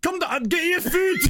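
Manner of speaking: high-pitched hammed-up Glaswegian; Glaswegian accent. Delivery caller telling him to come down, exaggerated Glaswegian accent. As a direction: go all in on a mock Glaswegian accent. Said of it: "like a Glaswegian voice was like".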